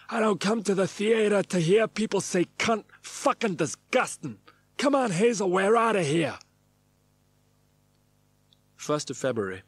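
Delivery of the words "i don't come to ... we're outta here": loud, high, gruff mock outrage; growling theatregoer. Man beside him at the interval storming out in a loud huff. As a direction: bellow it like a disgusted old punter. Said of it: "the man to my right growls".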